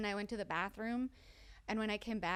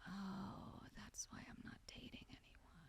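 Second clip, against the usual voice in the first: hushed unvoiced confession; whispered realization; 4 semitones lower, 19 dB quieter than usual. Hungover the next day, whispering. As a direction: whisper it to yourself. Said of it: "the next day I was like".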